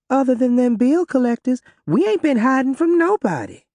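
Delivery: high, defensive protest. A narrator's reading. Othella snapping back at Ruby at the door, defensive. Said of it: "Othella said in a very defensive manner".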